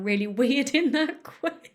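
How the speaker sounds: breaking into giggles mid-sentence; laughter bubbling through the words; laughing at herself. Real, not acted. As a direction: crack up at your own slip. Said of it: "I'm just laughing at myself".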